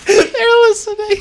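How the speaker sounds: high, slow words through a fit of laughter; laughing. Real, not acted. Co-host joking the app is listening, laughing. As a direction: barely get it out while cracking up.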